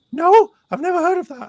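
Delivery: very high, loud put-on voice; fan impression. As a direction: answer in a loud, squeaky-high mimicking voice. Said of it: "he was like".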